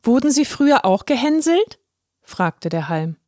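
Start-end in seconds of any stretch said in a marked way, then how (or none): 0.0-1.8 a higher, earnest little voice; the shy straw explaining himself; young straw Halme. A narrator's reading. Halme asks.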